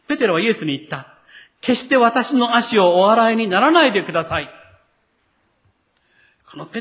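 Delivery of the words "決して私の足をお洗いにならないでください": Peter refusing, louder and firm; strong commanding tone. Acted. Peter refusing his master's footwashing, louder and commanding. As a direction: order it, don't ask. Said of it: "とても強い口調です。".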